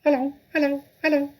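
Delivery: high squawky parrot imitating a human. Parrot greeting the children with a high squawk. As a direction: chirp it out like a talking bird. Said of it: "came the voice again".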